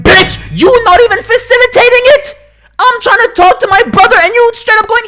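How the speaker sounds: very high, loud screeched comeback; outraged retort. Retold or imitated. Son retells snapping back at mom, screeching outrage. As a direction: yell it in exaggerated, high-pitched outrage. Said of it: "And I'm like".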